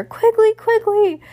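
very high, excited shout; high-pitched, loud cry to a friend. A narrator's reading. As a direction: shout the name out at full pitch. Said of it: "And then she said it louder".

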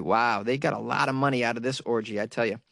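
ordinary talk, nothing marked

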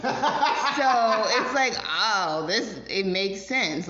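laughing through the words, stretched out slow; real laughter bubbling up mid-sentence. Real, not acted. Woman laughing to her cohost about cheating exes, laughing. Laughing while telling a story.

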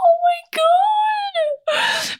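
squealing through laughter; high-pitched laughing oh my God. Real, not acted. Laughing fit.

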